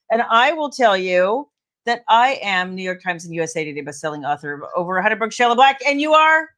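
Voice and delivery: fast auctioneer rattle; auctioneer patter. Real, not acted. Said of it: "I sound like an auctioneer".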